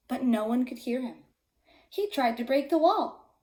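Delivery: ordinary delivery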